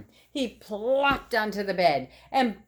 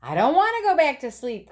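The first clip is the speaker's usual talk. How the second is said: put-on higher, louder, whiny child protest; protesting cry; 8 semitones higher, 5 dB louder than usual. Scene: Froggy protests to his mother, higher whiny cry. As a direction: whine it out like a kid. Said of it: "cried Froggy".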